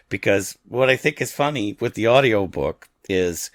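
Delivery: ordinary talk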